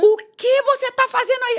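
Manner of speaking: put-on shrill-free high stern call to a child; mother catching daughter in her makeup; scolding mother. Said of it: "como já fiz com a minha pequena".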